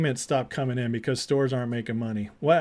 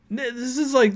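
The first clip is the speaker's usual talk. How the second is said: ordinary delivery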